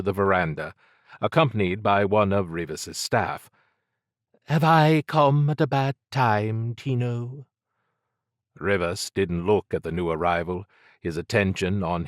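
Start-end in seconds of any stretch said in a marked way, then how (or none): 4.5-7.5 higher, lightly put-on English upper-class voice, teasing; a posh English newcomer needling Tino. A narrator's reading. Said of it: "Their accent was English upper class".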